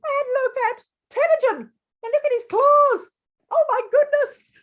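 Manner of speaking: very high, breathless-with-horror exclaiming; high, appalled reaction; mock alarm. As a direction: squeak it in storybook fright. Alarmed at tiger's claws in picture.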